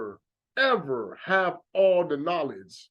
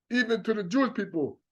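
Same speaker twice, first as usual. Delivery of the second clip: ordinary delivery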